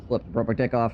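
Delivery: usual speaking voice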